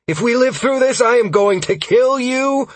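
high, loud furious vow; angry threat. A narrator's reading. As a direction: shout the threat mid-battle. Sierra threatens during battle.

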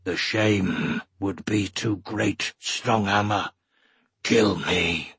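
low, slow Urgel rumble; deep creature voice, heavy with shame; rumbling. A narrator's reading. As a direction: rumble it gravely, word by word. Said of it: "then rumbled".

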